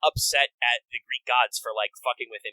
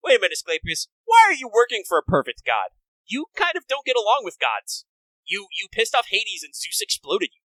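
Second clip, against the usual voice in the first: higher and louder, in disbelief; incredulous; 7 semitones higher, 5 dB louder than usual. Retold or imitated. Fan challenging a character, incredulous and higher. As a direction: call him out, mock baffled.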